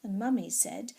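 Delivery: ordinary delivery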